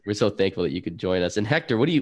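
normal talking voice

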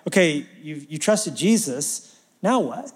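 ordinary talk, nothing marked

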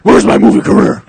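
loud, high, raspy Batman shout; hammed-up Batman yell. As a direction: rasp it out as an overblown Batman. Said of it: "my favorite fucking Batman voice ever".

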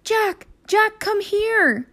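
high, slow far-off call. Acted. Annie calls Jack into the woods, high and slow.

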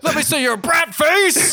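high, slow, laughing tease; playful high voice. Pretend. Teasing her co-host about brat face, high and laughing.

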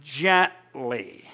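"gently" said very low and soft; emphasis on gently. Real, not acted. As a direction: set "gently" apart, dropped low and hushed. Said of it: "I want to emphasize the word".

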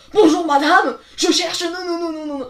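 a loud, high panicked greeting that collapses into whimpering nos. Retold or imitated. A new switchboard operator panics on her first call, mock-whimpering. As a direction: lose it mid-sentence as panic takes over. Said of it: "Oh la panique".

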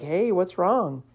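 soft, slow and gentle; tender comforting. Retold or imitated. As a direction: ease into it like soothing a crying child. Mom leaning over the bathtub.

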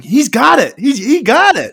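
high, loud hopeful cheer; excited shout. Retold or imitated. Fan watching the field goal, excited shout. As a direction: cheer the kick as it sails. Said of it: "I was like".

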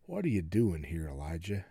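very low, soft voice of God; hushed, low-pitched divine question; still small voice. Acted. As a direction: ask it gently, nearly under your breath. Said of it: "a voice came to him and said".

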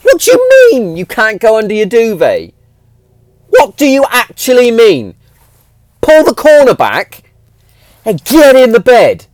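high, loud frustrated telling-off; exasperated dad. Retold or imitated. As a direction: raise your voice at a stubborn child. Dad arguing with daughter at bedtime.

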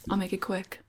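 hushed and soft; quiet aside. Pretend. Mock quiet before reading.

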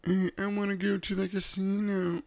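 high-pitched mock nagging wife; pestering wife. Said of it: "bugging me".

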